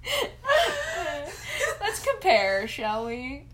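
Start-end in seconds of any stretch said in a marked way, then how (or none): 0.3-1.8 a loud, squealing burst of laughter; a high squealing laugh at a crude joke; high shriek of laughter. Real, not acted.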